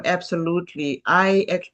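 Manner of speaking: ordinary talk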